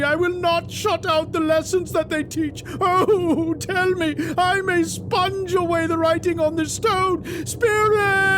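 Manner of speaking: building to a high, loud anguished cry; desperate Scrooge. Acted. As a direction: plead ever harder until it breaks. Scrooge's desperate final prayer.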